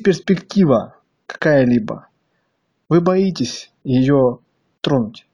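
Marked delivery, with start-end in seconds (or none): none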